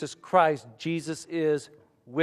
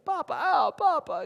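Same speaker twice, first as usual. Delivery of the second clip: very high, louder mock toddler wail; crying little kid; 6 dB louder than usual. Little kid stuck in timeout crying for Grandpa, imitated wailing. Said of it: "the little kid just crying".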